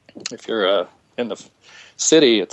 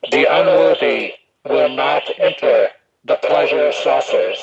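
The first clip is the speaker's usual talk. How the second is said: slow, halting put-on character voice; A Paul voice; 4 semitones higher than usual. Shown as a demonstration. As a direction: pronounce it as an eerie, altered voice, word by word. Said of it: "use my A Paul voice".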